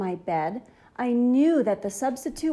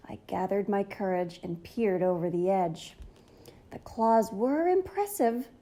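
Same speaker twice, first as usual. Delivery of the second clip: ordinary delivery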